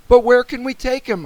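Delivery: high, loud, urgent; anxious question. A narrator's reading. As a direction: ask desperately for a solution. Boy asks anxiously.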